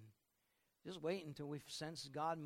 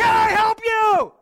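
much higher and louder, slow shout; yelling over noise; 26 dB louder, slower than usual. Retold or imitated. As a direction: shout over the blaring TVs. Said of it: "waitress has to come to the table and say".

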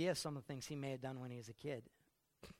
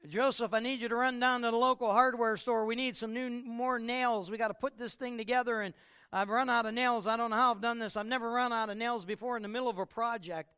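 mildly raised father; 7 semitones higher, 8 dB louder than usual. Acted. Joseph voiced, complaining.

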